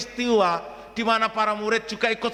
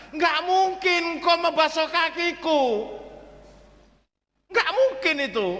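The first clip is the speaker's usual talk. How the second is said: higher, insistent Peter protest; 9 semitones higher than usual. Retold or imitated. Peter protests to Jesus about the washing, higher and insistent. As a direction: voice Peter's stubborn disbelief. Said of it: "Petrus sadar betul".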